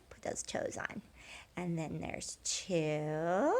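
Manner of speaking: slow whispered aside. Real, not acted. Art teacher whispers while drawing feet. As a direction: breathe it out while drawing toes.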